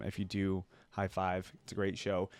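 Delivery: ordinary delivery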